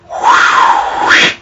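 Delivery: breathy unvoiced whew of awe; whistled whew. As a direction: blow out an impressed whew. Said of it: "I go like".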